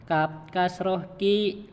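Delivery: slow, clear "Kap kasroh ki"; teacher modelling "Kap kasroh ki"; slow spelling drill. Shown as a demonstration. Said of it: "Saya dulu, Pak, ya".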